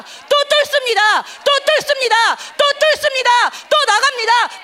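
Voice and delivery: building from loud to a full high shout; preacher crying out to God, building to a shout; fervent crescendo prayer. Acted.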